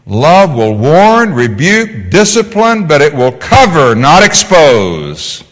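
loud, slow, preacher's cry; preaching shout. Real, not acted. Preacher to the congregation, loud and slow. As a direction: hammer each word out to the church.

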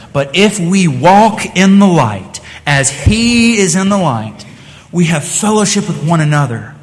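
slow, weighty Bible reading; scripture reading. Acted. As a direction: lean on each word of the verse. Reads scripture aloud.

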